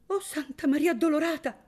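high, shocked plea to the Virgin; frightened exclamation. A narrator's reading. Chiarina hearing talk of a crime, frightened cry. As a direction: cry out, pale with fear. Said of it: "esclamò donna Chiarina, bianca come un giglio".

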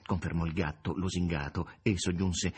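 usual speaking voice

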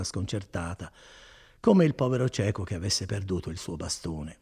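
ordinary delivery, nothing marked

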